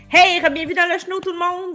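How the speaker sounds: bright, loud, high show welcome; excited greeting. Real, not acted. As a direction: open the show with a burst of energy. Host's energetic show opening.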